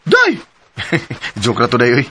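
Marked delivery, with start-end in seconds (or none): 0.0-0.3 sudden high yelp of a word; playful shout. Acted. Boyfriend jokingly swearing his promise, high playful shout. Joking oath "hope to die. 0.8-1.4 soft unvoiced chuckle; breathy laugh. Boyfriend laughing off his joke to girlfriend, soft chuckle.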